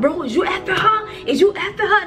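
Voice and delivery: high-pitched accusing retold yell; mock yelling. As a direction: imitate him yelling an accusation. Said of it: "he yelling at Nico's".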